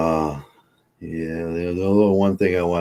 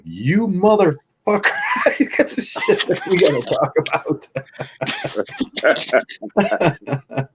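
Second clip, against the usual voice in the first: mock funeral yell; 13 semitones higher than usual. Friend yelling at the casket as a joke. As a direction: holler at the dead friend, half laughing. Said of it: "he was like".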